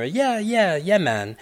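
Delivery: light Jamaican patois, high and easy; Jamaican accent. Retold or imitated. Author voicing an imagined Jamaican brother, in a light Jamaican accent. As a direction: imitate a relaxed Jamaican brother. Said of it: "as a Jamaican would say".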